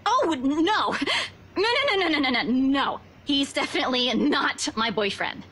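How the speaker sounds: rapid tumbling string of denials, pitch swooping down; flustered denial. Acted. Woman denying he is her boyfriend, flustered. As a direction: hurry to shut the idea down.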